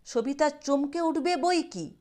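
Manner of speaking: ordinary delivery, nothing marked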